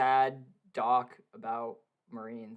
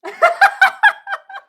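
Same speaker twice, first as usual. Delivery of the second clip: shrieking laugh; 16 dB louder than usual. Real, not acted. Friend's pain on call.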